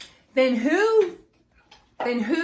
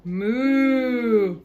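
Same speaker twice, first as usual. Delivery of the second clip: a louder lowing moo; cow moo; 4 dB louder than usual. Acted. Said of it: "Cow said".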